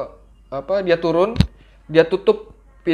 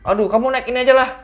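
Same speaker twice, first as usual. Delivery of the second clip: higher, louder and fast; bossy driver; 9 semitones higher, 6 dB louder, faster than usual. Retold or imitated. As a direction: brush the kid along impatiently. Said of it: "kata supir angkot tuh".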